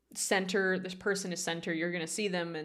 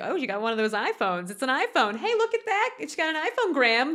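too talkative mom; 5 semitones higher, 4 dB louder than usual. Retold or imitated. Mom at the table straining to make conversation, over-talkative. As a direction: imitate a mom trying too hard. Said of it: "her mom being too talkative".

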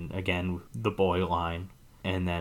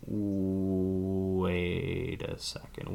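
very slow, drawn-out "wait"; drawn-out double take; 5 dB quieter, much slower than usual. Retold or imitated. Voicing the baffled audience at the twist, long drawn-out disbelief. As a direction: stretch the first word way out in mock disbelief. Audience's puzzled reaction acted out.

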